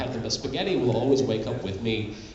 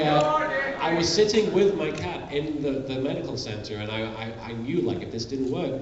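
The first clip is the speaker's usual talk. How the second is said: usual speaking voice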